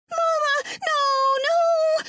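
put-on very high, loud child's wail; high, drawn-out anguished cry; grief-stricken wail. A narrator's reading. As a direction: sob it out over your dying mother. Boy at dying mother's side.

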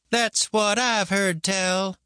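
slow, high, stubborn grandma. A narrator's reading. Grandma insisting to doubting boy, stubborn. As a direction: stretch it out and refuse to budge. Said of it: "she said stubbornly".

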